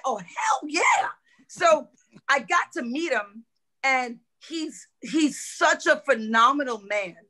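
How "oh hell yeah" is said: high, slow cry of delight; joyful whoop of approval; hell yeah excitement. Retold or imitated. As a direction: cheer it out like a thrilled fan. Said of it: "I was like".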